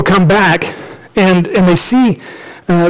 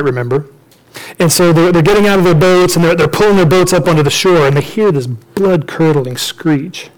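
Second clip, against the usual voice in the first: ordinary talk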